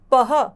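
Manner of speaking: पह called out loud with a breathy ह; clear demo. Shown as a demonstration. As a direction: model पह for the class. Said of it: "प के साथ अह". (पह ज़ोर से बोलकर दिखाना)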